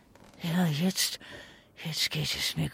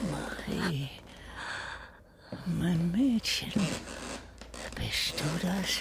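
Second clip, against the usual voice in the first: soft, unsure whispered question; confused whisper; 7 dB quieter than usual. Acted. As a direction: whisper it half awake. Confused old man calling his granddaughter.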